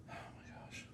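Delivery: hushed, voiceless awe; real breath-only murmur of amazement; awed whisper. Real, not acted. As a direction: whisper it, overwhelmed by the scent. Overwhelmed on smelling the scent.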